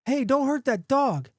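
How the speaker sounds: slow, high-pitched protest; calling from the crowd. A narrator's reading. Bystander at the back of the bar, calling out. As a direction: call out a warning across the room. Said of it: "A voice from the back of the crowd".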